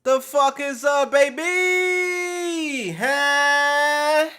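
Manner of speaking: high, loud, stretched-out hype call; hyped greeting shout. Real, not acted. Streamer hollering hello to his chat, loud and high hype. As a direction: holler out to the chat like the party just started.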